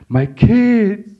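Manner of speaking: high, loud desperate cry; cry for help. Retold or imitated. Father facing oncoming truck thinks of children, crying out. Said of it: "cry for help".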